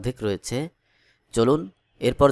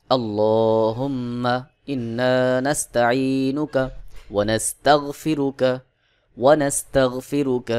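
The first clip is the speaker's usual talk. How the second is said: slow, measured Arabic chant with long-held vowels; Quran-style recitation; 4 dB louder, much slower than usual. Shown as a demonstration. Teacher reciting Dua Qunut for learners to memorise, slow chanted recitation. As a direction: recite phrase by phrase, pausing for learners to follow. সেভাবে এবার পড়ে শোনাব.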